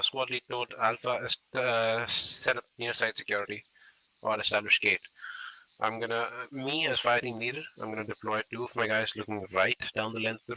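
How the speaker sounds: loud commands called to the fire team; firm squad orders. Shown as a demonstration. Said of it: "I'll demonstrate how to do it".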